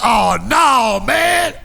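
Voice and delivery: loud, high disbelieving shout; amazed kids. Retold or imitated. Drug boys shocked by his drawing, loud amazed. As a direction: kids can't believe it. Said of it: "Then they get".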